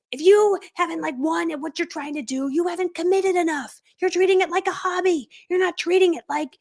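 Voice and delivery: high, pushy coach voice, playfully imitating a yell; imitating Tony Robbins yelling at his audience; mock yelling Tony Robbins. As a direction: ham up a motivational speaker ranting. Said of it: "and he's, like, yelling".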